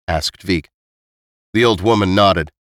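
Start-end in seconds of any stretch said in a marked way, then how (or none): none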